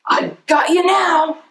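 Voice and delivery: high taunting alien; gleeful space alien gloating; villain taunt. Acted. An alien taunting its foe, high voiced.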